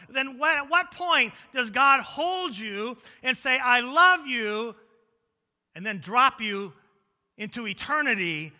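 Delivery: high, loud sermon crescendo; impassioned preaching. Real, not acted. Preacher challenging congregation's theology of love, impassioned preaching. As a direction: press the challenge on the congregation loudly.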